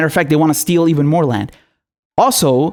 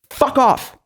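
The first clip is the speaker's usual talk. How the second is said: curt angry dismissal; 7 semitones higher than usual. Real, not acted.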